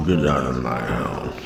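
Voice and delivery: low, soft anger through gritted teeth. Shown as a demonstration. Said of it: "they speak through gritted teeth".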